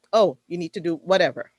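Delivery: ordinary talk, nothing marked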